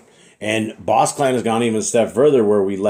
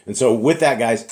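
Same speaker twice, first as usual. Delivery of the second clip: ordinary delivery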